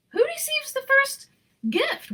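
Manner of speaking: put-on high, eager children's question; children asking. A narrator's reading. The children ask the conductor, high and eager. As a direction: ask it like excited kids. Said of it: "we all asked".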